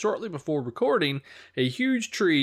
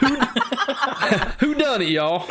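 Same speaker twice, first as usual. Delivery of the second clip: Southern accent; 8 semitones higher than usual. Shown as a demonstration. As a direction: ham up a Southern twang. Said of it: "when you say whodunit in a Southern accent".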